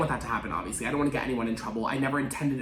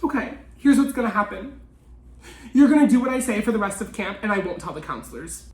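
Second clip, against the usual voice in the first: smug blackmailer; 7 semitones higher, 6 dB louder than usual. Retold or imitated. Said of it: "He's like".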